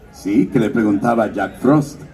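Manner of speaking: usual speaking voice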